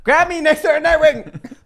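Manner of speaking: pleading call. Acted. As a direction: whine for rescue. Player begging ally again.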